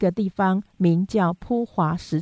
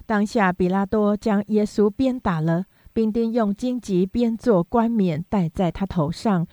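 plain reading voice

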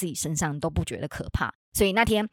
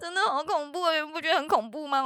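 spooked; 13 semitones higher than usual. Real, not acted. Scared retelling a ghost sighting.

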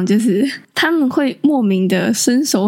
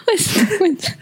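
high giggles through the words; laughing; 14 semitones higher than usual. Real, not acted. Laughing at the pushy granny story, high and giggly. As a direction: giggle while asking.

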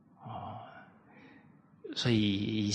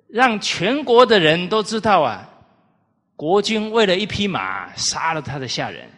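loud, higher accusing speech; mock scolding; 6 semitones higher, 6 dB louder than usual. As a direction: list the crimes with staged indignation. Minister scolds the condemned man. (义正辞严地假意斥责)

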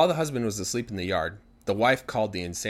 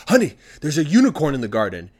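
louder, higher, urgent wake-up call; excited husband; 5 semitones higher, 9 dB louder than usual. Acted. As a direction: blurt out amazing news to a sleeping wife. Husband excitedly wakes his wife.

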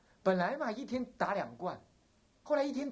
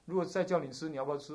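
usual speaking voice

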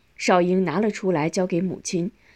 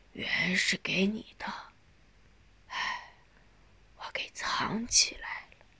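faint, slow dying whisper; halting, broken speech; 11 dB quieter than usual. A narrator's reading. Dying mother presses savings on son, haltingly. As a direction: stop and restart, word by word, breathless. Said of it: "断断续续地说".

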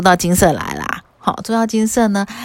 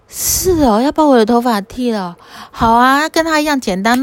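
put-on higher, surprised then eager girlish voice; a lightly voiced naive girl jumping at the idea; 5 semitones higher than usual. Matangi girl agrees to shave her head, eager higher voice.

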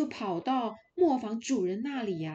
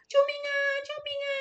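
much higher, fast frightened little goats; panicked kids scattering from the wolf; cries for help; 4 dB louder, much faster than usual. Acted. Little goats running from the wolf, crying for help in fright.

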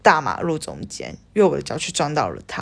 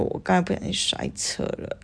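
sheepish whisper; 8 dB quieter than usual. Retold or imitated. Sheepish admission to friend.

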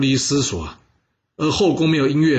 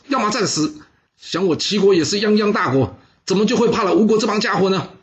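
higher, fiery call to fight to the death; rousing war speech; 7 semitones higher than usual. Acted. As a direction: fire up the generals. Envoy rallies the generals. (慷慨激昂)

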